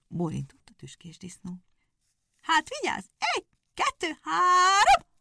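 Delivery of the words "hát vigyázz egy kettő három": very high, loud countdown; hare counts off. Acted. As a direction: call the start, rising to a shout on három.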